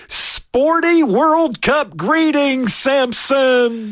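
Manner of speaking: bright, high-pitched on-air greeting; chipper 1950s ad. Real, not acted. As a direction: sing out the greeting cheerfully.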